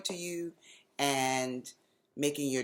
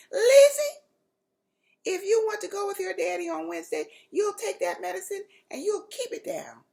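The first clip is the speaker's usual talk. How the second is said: put-on high, loud, faster grandmother voice warning the child; stern grandmother warning; 10 dB louder than usual. A narrator's reading. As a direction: warn her like a firm grandma who won't budge. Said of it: "Grandmommy warned".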